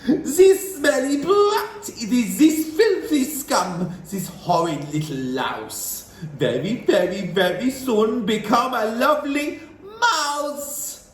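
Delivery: loud, high, slow gloating; witch shouting. A narrator's reading. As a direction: spit the insults with glee. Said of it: "and then she shouted".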